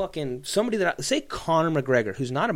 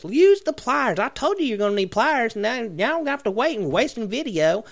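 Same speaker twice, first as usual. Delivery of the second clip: pitched way up, scolding Daryl; high nagging wife voice; 8 semitones higher than usual. Retold or imitated. Woman in video scolding Daryl, high nagging voice. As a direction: nag him shrilly about the pliers. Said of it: "voicing woman in the video".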